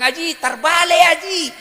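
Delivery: high, loud retold warning; shouted correction. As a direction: call out the mistake in comic exasperation. (seruan koreksi gemas, nada tinggi)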